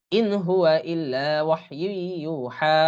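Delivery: slow, measured tajweed reading; Quran recitation. Shown as a demonstration. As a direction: recite the verse with drawn-out vowels. Teacher recites the verse. (ধীরে তাজবিদসহ তিলাওয়াত)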